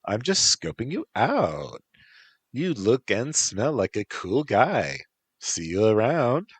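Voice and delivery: put-on high breezy Valley Girl. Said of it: "said a Valley Girl voice".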